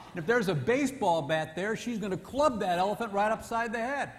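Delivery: normal talking voice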